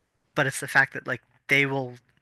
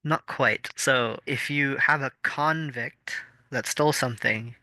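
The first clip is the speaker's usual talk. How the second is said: ordinary talk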